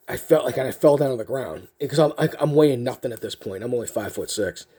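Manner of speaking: usual speaking voice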